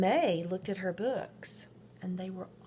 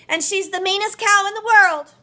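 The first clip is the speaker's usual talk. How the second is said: put-on high, loud and quick child voice; boastful little boy; 12 semitones higher, 19 dB louder, faster than usual. A narrator's reading. Marvin telling his family about Rosie, high and fast. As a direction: rush it out proudly. Boy echoing sister's insult.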